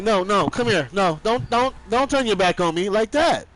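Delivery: high, loud pleading yell; loud call after him. Retold or imitated. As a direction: shout after him not to turn his back. Calling after someone walking off.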